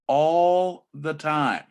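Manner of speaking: ALL drawn out long and loud, falling away; very slow, weighty repeat with stress on ALL; emphatic. Real, not acted.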